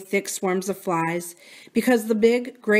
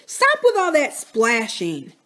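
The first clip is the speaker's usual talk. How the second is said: complaining; 5 semitones higher, 3 dB louder, slower than usual. A narrator's reading. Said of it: "Buki complained".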